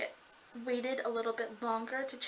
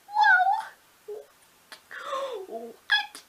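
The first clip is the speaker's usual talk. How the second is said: high, loud drawn-out whoa; amazed reaction; 6 dB louder than usual. Retold or imitated. Fan reliving the demonic wings reveal, amazed high squeal. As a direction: squeal it in astonishment.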